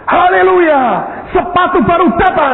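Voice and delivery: high, loud hallelujah cry; shouting. Retold or imitated. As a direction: yell the good news out at full voice. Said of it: "saya teriak gini".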